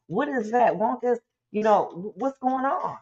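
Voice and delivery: a light, hammed-up bewildered self; mock confusion. Imitating confused mind.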